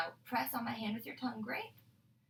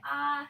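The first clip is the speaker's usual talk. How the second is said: a clear, louder demo ahh; saying ahh; 5 semitones higher, 7 dB louder than usual. Shown as a demonstration. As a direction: open wide and say ahh. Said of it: "Open up and say".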